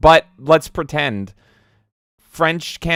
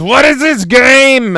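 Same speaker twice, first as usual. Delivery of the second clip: high, drawn-out full shout; exclamation-mark shout; 12 semitones higher, 11 dB louder, slower than usual. Retold or imitated. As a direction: yell it as one big exclamation. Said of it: "taking his exclamation mark as gospel".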